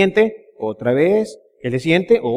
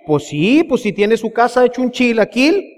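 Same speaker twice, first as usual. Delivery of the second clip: ordinary talk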